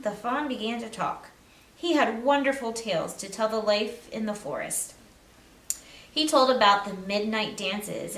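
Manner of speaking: plain reading voice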